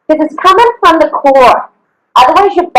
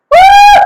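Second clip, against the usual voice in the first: high-pitched workout "woo"; a big shouted whoop of effort; 4 dB louder than usual. Real, not acted. Trainer to class as the burn hits, high whoop.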